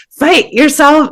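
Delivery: high, loud mock alarm; a frantic inner alarm yelling; survival panic. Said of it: "survival system voiced".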